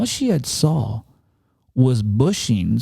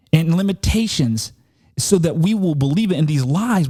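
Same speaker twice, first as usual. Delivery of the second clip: higher, fervent preaching voice; 6 semitones higher than usual. Acted. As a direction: preach it with rising conviction to the congregation. Preaches about fear.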